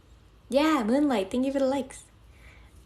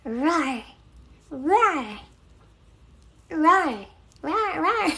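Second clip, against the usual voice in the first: a playful little roar that gets louder and higher each time; cute mock roar; 7 semitones higher, 4 dB louder, much slower than usual. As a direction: roar like a tiny cartoon lion on request. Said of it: "You want me to roar?".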